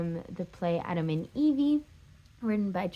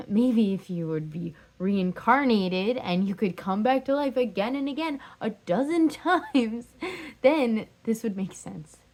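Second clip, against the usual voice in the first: louder, climbing incredulous outburst; exasperated ex-lover; 3 semitones higher, 5 dB louder than usual. Acted. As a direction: argue bitterly with the one who left you. Acted outburst at leaving lover.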